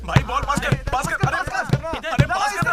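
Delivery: high, loud, urgent calls; shouting for a pass. Acted. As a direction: yell for the ball across a court. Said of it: "तू क्यों पास पास चिल्ला रहा था". (पास के लिए चिल्लाते हुए)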